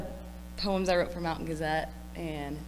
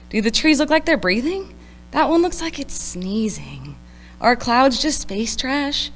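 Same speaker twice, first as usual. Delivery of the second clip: wide-eyed higher-pitched musing; playful, dazed wonder; whimsical tripping friends; 3 semitones higher, 6 dB louder than usual. Acted. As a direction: marvel like someone tripping on the river. Acts out tripping friends in poem.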